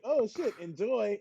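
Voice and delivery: mock cheerful send-off. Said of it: "And I was like".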